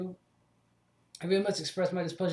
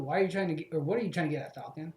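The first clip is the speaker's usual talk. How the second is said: usual speaking voice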